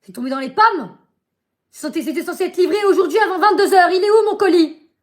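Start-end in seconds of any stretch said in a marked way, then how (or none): 1.7-4.9 higher and louder, laughing through it; exasperated laughing complaint, higher and louder; laughing in disbelief. Real, not acted. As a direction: laugh while complaining about the parcel. Laughing in disbelief at lost parcel.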